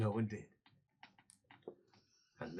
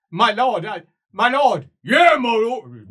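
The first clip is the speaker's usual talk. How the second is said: higher, louder alarmed calling; panicked cry; 12 semitones higher, 23 dB louder, slower than usual. Acted. Servant cries to his lord as the ogre charges. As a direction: call out in alarm.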